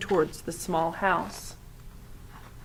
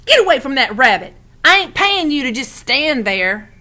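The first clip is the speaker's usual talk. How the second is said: loud, fast, higher scolding; 6 semitones higher, 8 dB louder than usual. A narrator's reading. Old woman scolds boy for idling, loud fast scold. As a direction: tell him off sharply.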